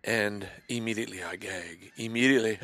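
usual speaking voice

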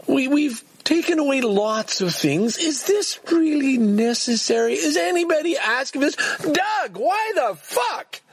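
high-pitched, indignant protest; exasperated outburst. Real, not acted. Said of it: "Okay, sorry".

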